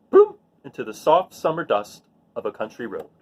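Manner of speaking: put-on gentle storybook reading voice for children; read-aloud narration. A narrator's reading. Teacher reading a rain picture book to her students, gentle storytelling voice. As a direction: read it like story time to a class.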